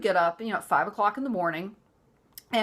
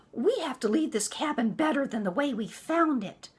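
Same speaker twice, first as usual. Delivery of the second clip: mock mother-in-law; 6 semitones higher than usual. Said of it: "I'm like".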